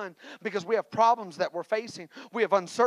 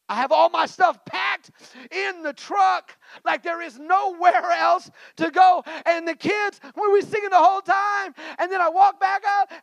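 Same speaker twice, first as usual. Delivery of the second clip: loud, raised preaching voice building up; preaching at full force; 11 semitones higher, 11 dB louder than usual. Acted. Preacher stirring the church with his moving story, loud and raised. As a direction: preach it to the congregation.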